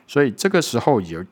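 ordinary talk

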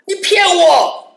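high, loud accusing cry in her voice; mock accusation. Girl confronts the traveller, high and loud accusing. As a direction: fling the accusation at him.

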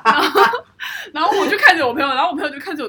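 laughing hard, loud; can't stop laughing. Real, not acted. Recalling the scene, laughing. (大笑着说)

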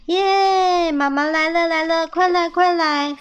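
high, loud welcoming cheer; joyful cheering. A narrator's reading. Little squirrels welcoming mom, joyful. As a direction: cheer and hurry her over, pitch up.

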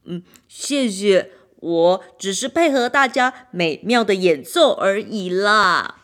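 the drum humbly thanking the others, loud and slow; modest drum. A narrator's reading. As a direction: give the credit away kindly.

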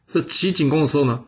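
ordinary delivery, nothing marked

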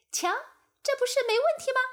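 high, loud boastful fly; smug fly. Acted. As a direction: show off to the others. Fly back from honey. (得意的苍蝇)

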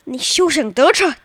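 hammed-up dramatic challenge; mock defiance. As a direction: play at foiling a villain's scheme. Child playfully defies mother, theatrical.

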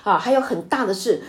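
usual speaking voice

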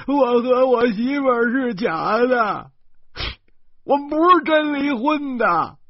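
高声哭诉，边哭边说; 抱着哥哥的腰嚎啕哭诉; 哇哇大哭. A narrator's reading. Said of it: "他哇哇大哭". (弟弟抱着哥哥哇哇大哭地哭诉)

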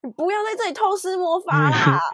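high-pitched playful telling-off; mock scolding. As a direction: scold in pretend alarm, voice pitched well up. Acts out a magical scolding. (假装尖声责备)

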